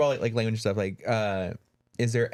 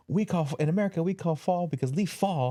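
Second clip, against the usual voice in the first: a much higher, hammed-up American explaining "fall"; mock American guy; 9 semitones higher than usual. Mocking an American explaining why he calls it fall, much higher. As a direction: play a simple-minded American, pitched well up. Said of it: "And the guy's like".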